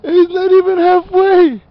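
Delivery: high, loud and slow through laughter; laughing teasing about barely halfway. Real, not acted. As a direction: squeal it out while cracking up. Laughing at partner's exhaustion.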